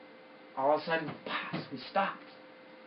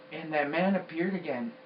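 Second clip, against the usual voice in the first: ordinary delivery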